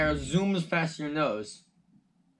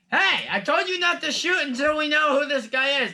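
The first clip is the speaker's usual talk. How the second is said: high, loud, barking order; commanding voice; 11 semitones higher, 6 dB louder than usual. Acted. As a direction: bark it at the archers. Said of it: "the commanding voice says again".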